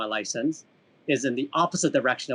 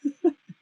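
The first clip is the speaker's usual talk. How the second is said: short high-pitched laugh; 14 semitones higher than usual. Real, not acted. Laughs at own confession.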